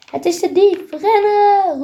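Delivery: high, loud alarm cry; calling out. Acted. Floor spots the thief, shouting to run. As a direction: yell at her friend to run. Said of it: "roept Floor".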